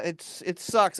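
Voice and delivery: normal talking voice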